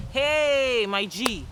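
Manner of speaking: high, loud "hey", dropping after; buddy hail. Shown as a demonstration. Demonstrating hail to a friend, enthusiastic buddy greeting. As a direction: call out to your pal, big and bold.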